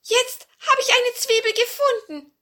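very high, loud dwarf voice; squeaky little dwarf shout. Acted. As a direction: announce it like an excited tiny dwarf. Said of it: "sagte der".

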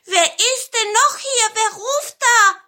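high, loud startled question; startled calling out. A narrator's reading. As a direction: call out to someone you can't see. Startled cone calls to unseen speaker. (hoch und laut erschrocken rufen)

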